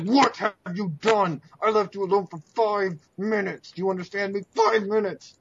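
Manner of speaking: grab him and demand answers; enraged at a reckless ally; furious demand. Acted. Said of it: "and be like".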